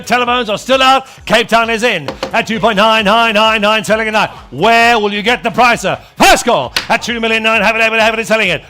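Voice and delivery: ordinary talk, nothing marked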